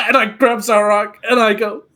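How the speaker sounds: hammed-up loud sobbing; mock crying. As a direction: wail it theatrically. Fake crying grabbing Zarok.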